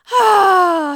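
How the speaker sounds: high, loud exhale; loud sigh. Real, not acted. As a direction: let out a big loud sigh. Resets after the mock search.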